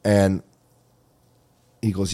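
ordinary talk, nothing marked

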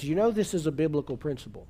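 ordinary delivery

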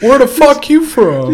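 high, loud, slow laughing jeer; mock gamer taunt. Gamer taunting him about his accent, high and loud. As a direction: holler it like a heckler. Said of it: "shit talking starts going".

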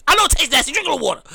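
ordinary delivery

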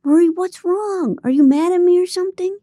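plead, rushing the second question; confused AJ. A narrator's reading. AJ asking Marie what's wrong under the covers, high and worried.

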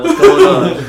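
high laughing greeting; laughing high impression; imitating Chris. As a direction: squeak out a mock hype welcome. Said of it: "Chris Butler on the line".

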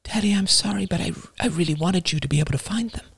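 put-on very high, soft little girl voice; a sorry young daughter, small and high; young daughter apologizing softly to her father. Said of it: "suddenly very high, very young".

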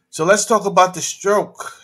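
normal talking voice

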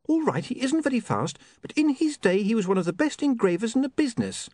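put-on high-pitched defensive young woman; high, indignant character voice; defensive Saccharissa.

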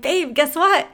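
high, eager announcement; excited. Retold or imitated. Telling fiance about the first sale, excited. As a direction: burst out with big news.

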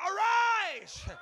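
high, full-voiced "arise"; shouted command. Shown as a demonstration.

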